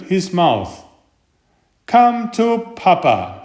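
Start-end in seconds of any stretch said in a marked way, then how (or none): 1.9-3.4 high, louder greedy call; a mock-greedy shout to the cookies. A narrator's reading. The tooth calling the cookies, hammed-up greedy shout. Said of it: "shouted the tooth".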